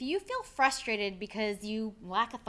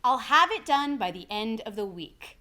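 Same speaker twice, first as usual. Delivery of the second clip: louder, decisive, WEEK falls like a period; downward inflection; 6 dB louder than usual. Shown as a demonstration. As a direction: state it firmly, dropping down onto "week". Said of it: "let's try it with a sentence".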